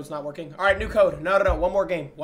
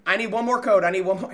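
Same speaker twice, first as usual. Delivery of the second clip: usual speaking voice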